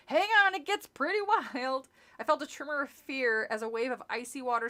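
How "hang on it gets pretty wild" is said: shouting. Acted. Counselor shouting over rapids.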